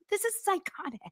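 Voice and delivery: high, soft cringing squeak. Real, not acted. Streamer cringing at texts, in a high squeak.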